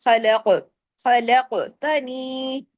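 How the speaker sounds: slow "खलकतनी" with thick "kha" and echoed q; "खलकतनी" drawn out, heavy letters and long final vowel; heavy bouncing qaf. Shown as a demonstration. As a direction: bounce the q in "खलक". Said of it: "heavy भी होगा".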